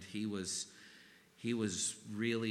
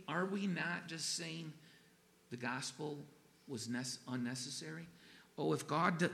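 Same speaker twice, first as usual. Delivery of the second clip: ordinary talk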